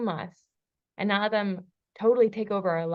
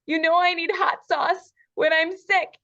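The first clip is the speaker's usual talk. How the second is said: put-on high, louder whiny little-boy voice; hurt little boy; 8 semitones higher, 6 dB louder than usual. Imitating her sick husband's inner hurt boy, high and whiny. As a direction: play the sick, wounded child asking for pity. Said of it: "He's like".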